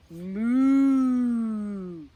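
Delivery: playful silly moo; mock cow moo. Host teasing the baby with a wrong lion sound, mock moo.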